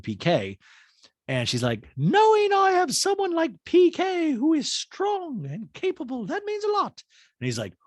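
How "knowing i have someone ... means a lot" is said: very high, slow put-on voice; Dorit impression. Dorit praising PK in dual talking head, high put-on voice. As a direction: coo it in a squeaky voice.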